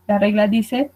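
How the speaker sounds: ordinary delivery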